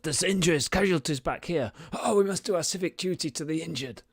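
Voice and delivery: pompous guard crying duty; a guard choosing to tend the injured over the chase; guards calling out. Acted. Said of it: "you hear shouts of".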